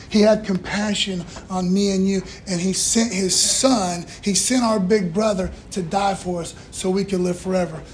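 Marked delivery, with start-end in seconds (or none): none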